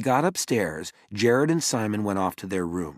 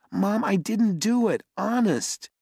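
pleading innocence; 12 semitones higher than usual. Acted.

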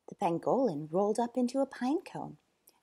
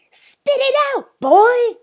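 high, booming shout; 17 dB louder, slower than usual. Acted. Zoo owner to stammering boy, booming. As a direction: boom it out impatiently. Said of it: "boomed Sir Basil".

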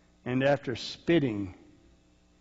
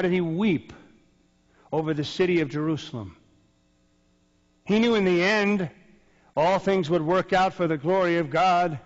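higher and louder, ringing; impassioned proclamation; 5 semitones higher, 7 dB louder than usual. Real, not acted. Preacher proclaiming to the congregation, impassioned. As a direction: declare it with full conviction.

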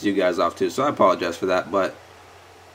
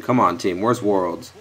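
ordinary talk, nothing marked

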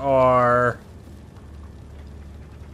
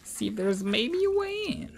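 silly high voice; 11 semitones higher, 6 dB quieter than usual. Pretend. Echoes own line in silly voice.

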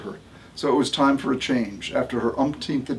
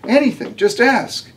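eager reply; 10 semitones higher, 3 dB louder than usual. A narrator's reading. Eager young doctor answers.

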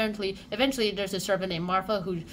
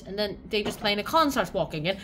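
ordinary delivery, nothing marked

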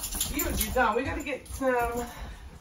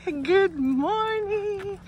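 vlog intro; 5 semitones higher, much slower than usual. Acted. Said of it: "let's start over".

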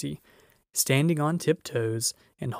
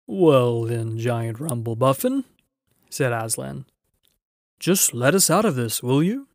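louder, lordly lion voice; a hint of a grand lion, louder; commanding lion; 5 dB louder than usual. A narrator's reading. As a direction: speak like a kindly king. Lion voice in story.

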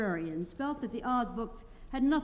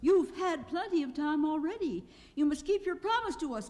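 high, louder demanding voice; insistent; 8 semitones higher, 3 dB louder than usual. A narrator's reading. Dorothy demanding Oz keep his promise, insistently. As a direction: insist firmly, pitched high. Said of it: "claimed Dorothy".

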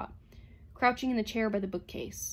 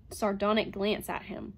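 usual speaking voice

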